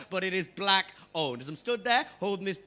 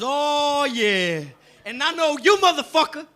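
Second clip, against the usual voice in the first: knowing salesman; 5 semitones higher, 6 dB louder, slower than usual. Retold or imitated. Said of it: "And he goes".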